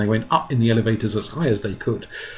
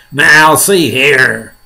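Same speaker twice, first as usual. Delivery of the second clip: loud, very slow, higher call; shouting; 4 semitones higher, 10 dB louder, much slower than usual. Acted. Police chief addressing the centipede, drawn-out shout. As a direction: shout it word by word. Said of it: "shouted the poli-, chief of police".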